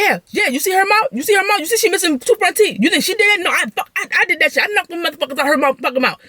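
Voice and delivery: high-pitched imitation of a boasting girlfriend; mock bragging. Girlfriend boasts about knocking out teeth, high mock bragging.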